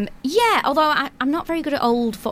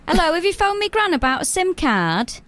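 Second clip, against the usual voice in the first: lightly put-on higher voice; higher-pitched character greeting; granddaughter role; 6 semitones higher than usual. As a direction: play the helpful granddaughter on the phone. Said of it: "grandmother and granddaughter".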